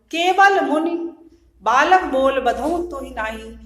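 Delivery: loud scripture declamation; verse recitation. Shown as a demonstration. Teacher repeating Parashuram's verse to students, loud recitation. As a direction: ring the verse out to the class.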